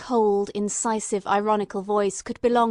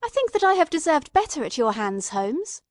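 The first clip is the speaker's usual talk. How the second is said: bitter reproach; 6 semitones higher, 5 dB louder than usual. Acted. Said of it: "I cried with some bitterness".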